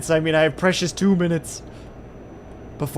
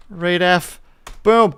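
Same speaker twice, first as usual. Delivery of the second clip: ordinary talk, nothing marked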